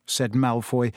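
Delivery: plain reading voice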